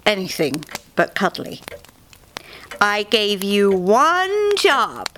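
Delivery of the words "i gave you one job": very slow, deliberate stern bunny; a touch of a stern boss; sternly. A narrator's reading. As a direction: lay down each word sternly. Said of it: "she said sternly".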